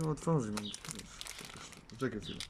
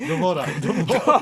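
higher, louder, laughing chant; giggly, raised-voice joke; 5 semitones higher, 6 dB louder than usual. Real, not acted. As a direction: crack up while repeating it. Friends cracking up while joking.

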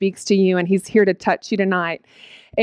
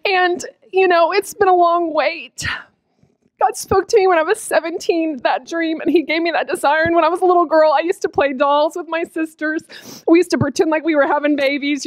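tearful, raised pitch; happy tears; 6 semitones higher than usual. Real, not acted. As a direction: talk on through tears of joy. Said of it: "I'm crying because I'm so happy".

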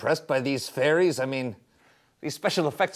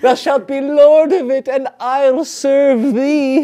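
eager laughing; 8 semitones higher, 10 dB louder than usual. Acted. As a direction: gleefully offer service.

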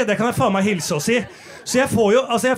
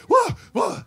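high triumphant whoop; relieved whoop; 14 semitones higher than usual. Retold or imitated. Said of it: "liksom sånn". (høyt jubelrop)

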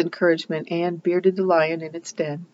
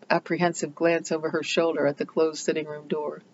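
ordinary delivery, nothing marked

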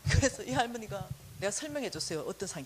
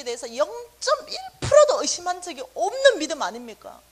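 angry prayer; 5 semitones higher, 7 dB louder than usual. Retold or imitated. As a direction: challenge God, building from hurt to fury. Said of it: "난 너무 화가 나더라고".

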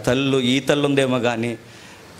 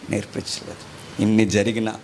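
normal talking voice

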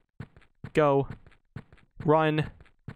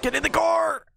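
panicked yell; 11 semitones higher than usual. Real, not acted. Still fleeing.

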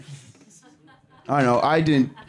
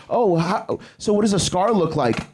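mocking question; 6 semitones higher than usual. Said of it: "they always ask".

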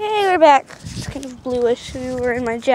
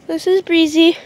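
ordinary talk

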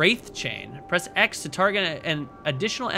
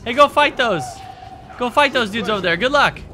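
calling out; 5 semitones higher, 7 dB louder than usual. Real, not acted. Urging on little allies.

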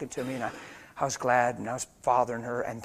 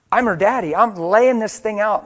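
preaching at full force; 7 semitones higher, 13 dB louder than usual. Acted. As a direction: lay it down hard like a father preaching.

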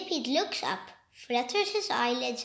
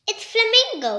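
high, loud beaming announcement; proud reveal; 9 semitones higher, 8 dB louder than usual. Acted. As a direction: announce him proudly, smiling. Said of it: "the millipede smiles".

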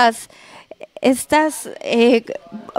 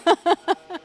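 a quieter giggle trailing off; soft real giggle; 6 semitones higher, 4 dB quieter than usual. Real, not acted. Host giggles after pointing at the wrong dish. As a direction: giggle at your own slip. Host laughs at own mistake.

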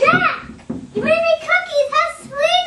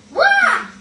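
annoyed shout; 5 semitones higher, 6 dB louder than usual. Acted. Sister complaining about channel.

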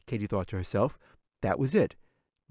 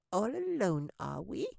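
very high, thin squeak, fading soft; tiny creature teasing from the dark; squeaky little voice; 12 semitones higher, 6 dB quieter than usual. A narrator's reading. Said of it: "a little squeaky voice that barely sounded human".

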